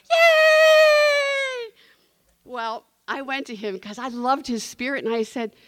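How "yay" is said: high, loud cheering shout; group cheer. Retold or imitated. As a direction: cheer like a whole room answering. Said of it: "we'd all go".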